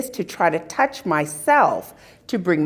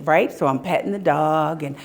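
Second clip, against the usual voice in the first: ordinary talk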